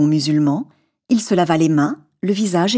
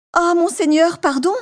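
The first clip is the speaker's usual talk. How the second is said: much higher cry for mercy; desperate cry; 11 semitones higher than usual. Acted. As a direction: merchant crying out to the genie, much higher. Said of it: "s'écria le marchand".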